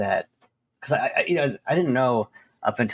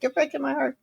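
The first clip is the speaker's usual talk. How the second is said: mock Italian mother. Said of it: "She's like".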